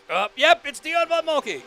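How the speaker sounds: high, loud eager call-out; excited recognition. Pretend. Fan spotting the reference, high excited call-out. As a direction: blurt it out like you just got it. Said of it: "and then somebody's like".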